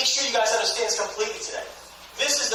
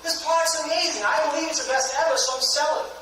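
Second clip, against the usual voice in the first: high, insistent mock family pitch; begging; 7 semitones higher than usual. Seller begging family to buy, pleading. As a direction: plead in a raised voice. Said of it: "I'm begging them because".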